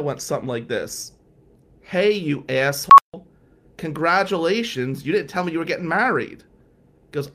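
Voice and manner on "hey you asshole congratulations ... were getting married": loud greeting. Retold or imitated. Friend's voicemail shouting congratulations.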